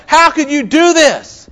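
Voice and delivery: high-pitched, drawn-out angry reproach; acted-out livid master. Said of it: "He is livid".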